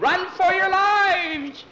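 very high, loud, slow drawn-out cry; hammed-up panicked alarm; panicked yell. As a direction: yell it as you bolt from the stall. Kid flees kicking horse.

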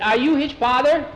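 high, loud amazement; astonished question. A narrator's reading. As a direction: blurt it out, amazed. Boy astonished at the stranger.

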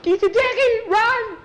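very high, loud scared shout; a terrified boy yelling run; panicked child. Acted. Skeeter panics at window.

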